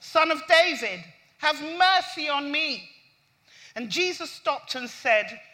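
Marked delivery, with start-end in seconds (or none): 0.0-2.9 loud, higher plea for mercy; insistent raised cry of a beggar; crying out insistently. Acted. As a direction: keep calling out, louder, over those hushing you. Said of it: "kept crying out all the more".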